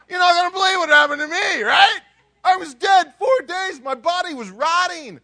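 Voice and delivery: high, full-volume shout of amazement; excited one-upping. Acted. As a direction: top his story at the top of your voice. Acts Lazarus topping the leper's tale.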